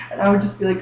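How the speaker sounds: ordinary talk, nothing marked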